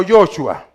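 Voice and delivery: Joshua with an "o" in the first syllable; Joshua, opening on a "yo" sound; name variant. Shown as a demonstration. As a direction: say Joshua as "YO-chua". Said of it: "Se lo explico".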